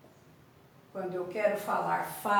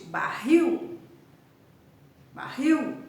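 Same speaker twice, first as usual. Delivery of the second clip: "barril" with the last syllable punched louder; stress on final syllable; 3 semitones higher, 6 dB louder, slower than usual. Shown as a demonstration. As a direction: hit the final -RIL of "barril" hard, pitch raised. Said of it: "é a sílaba forte". ("barril" com a última sílaba forte)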